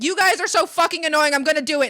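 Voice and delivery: loud, high exasperated outburst; re-enacted fed-up yell; retold angry yelling. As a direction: snap at them at full volume. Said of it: "I just, like, screamed at them".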